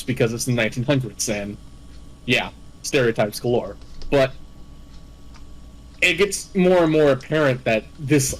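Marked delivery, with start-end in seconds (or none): none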